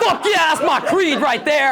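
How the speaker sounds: high, loud hyped yell. Retold or imitated. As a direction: pump it up like a bro. Hyped reply to kid.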